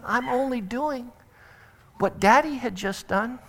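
put-on little girl about to cry; small hurt voice. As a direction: say it with a chin quiver. Child near tears defends herself.

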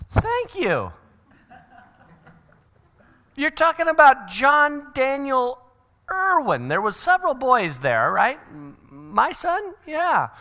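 high, hammed-up disbelief; double-check they really mean your kid; incredulous parent. Astonished father receiving praise of son.

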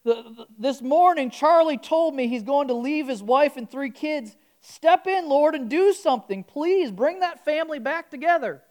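put-on pleading Southern accent prayer; southern twang. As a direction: beg the Lord in a country twang. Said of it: "How do you like my twang?".

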